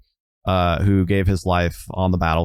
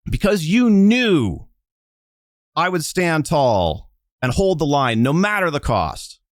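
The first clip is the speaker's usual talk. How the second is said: tearful yelling; 8 semitones higher than usual. Acted. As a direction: yell it in her face, then let it sink. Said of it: "yelling in Bridget's face".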